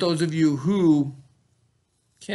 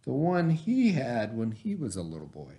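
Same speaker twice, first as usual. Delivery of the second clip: put-on reading a picture book aloud to children; story reading. A narrator's reading. Teacher reading a bike story to her class, storytelling voice. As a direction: tell it gently, like story time.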